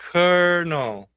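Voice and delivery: "Colonel" pronounced like "kernel"; correct pronunciation. Shown as a demonstration. Teacher repeating it for learners, "Colonel" as KER-nul. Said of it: "a pronúncia dessa palavra é".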